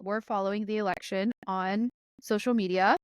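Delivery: ordinary delivery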